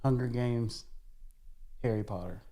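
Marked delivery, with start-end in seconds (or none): none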